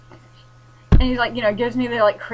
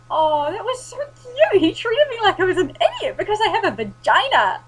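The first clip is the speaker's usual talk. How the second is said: loud mocking sarcasm; 12 semitones higher, 10 dB louder than usual. Walking out of the shop loudly mocking the salesman, high sarcastic sing-song. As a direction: overdo the fake sweetness so everyone hears. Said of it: "walked out quite loudly going".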